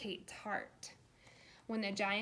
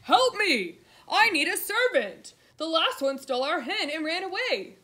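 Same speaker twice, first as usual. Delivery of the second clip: loud, higher cry for help; roaring giantess; 7 semitones higher, 12 dB louder than usual. A narrator's reading. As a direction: roar it out like a giant woman. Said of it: "she roared".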